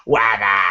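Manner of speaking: long drawn-out "wadaaa"; demonstrated silly cry. Shown as a demonstration. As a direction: blurt it out and hold the last vowel. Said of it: "Answer it like this".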